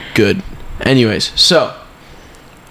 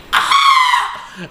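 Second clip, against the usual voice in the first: high laughing mock scream; retold scream. Retelling the leg cramp to his friend, laughing mock scream. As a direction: squeal it, half laughing. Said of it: "I'm, like, screaming".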